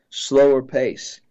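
ordinary talk

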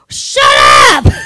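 yelled, far from his own voice; retold shout. Preacher voicing a caller yelling at her husband, loud shout. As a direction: bark it out as the fed-up wife. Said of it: "And she was like".

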